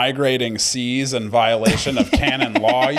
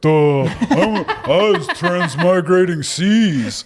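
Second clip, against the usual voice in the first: higher, slow, laughing dumb guy; 7 semitones higher, 3 dB louder, much slower than usual. Retold or imitated. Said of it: "doing a dumb guy voice".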